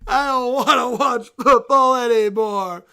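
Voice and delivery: stomp through the complaints like a sore loser; whiny tantrum. Acted. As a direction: bear fan sulking furiously at the loss. Bear wails about his team.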